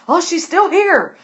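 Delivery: put-on a jumpy old servant's alarm; scared servant. Old servant tells her master of the beggar, alarmed. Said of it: "Hannah's still scared".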